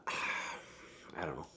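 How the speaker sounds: breathy, slow, whispered doubt; trailing off into a tired whisper; sighed whisper. Real, not acted. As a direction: let it out on a sigh. Sighing, unsure about the moon landing.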